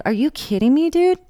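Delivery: incredulous, rising to a squeak on dude; hammed-up are you kidding me; outraged disbelief. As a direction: voice shooting up high in disbelief. Outraged at boyfriend.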